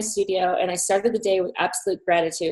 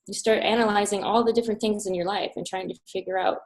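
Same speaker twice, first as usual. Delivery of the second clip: ordinary talk, nothing marked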